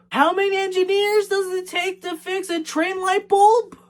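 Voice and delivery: high-pitched disbelieving question; incredulous. Real, not acted. Reviewer mocking the engineers' light bulb job, incredulous. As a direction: voice climbs and stays high in disbelief. Incredulous rant about the film.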